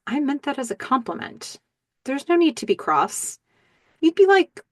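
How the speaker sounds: smooth it over in a lifted voice; mock placating. Man excusing his compliment to an offended date, mock placating.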